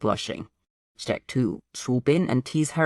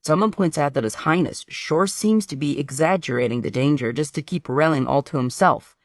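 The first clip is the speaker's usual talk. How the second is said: ordinary delivery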